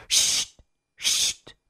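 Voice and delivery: chuchoter sèchement pour faire taire; un chut soufflé, sans voix; chut chuchoté. A narrator's reading. Ils font taire Alice. (chuchotement pour faire taire)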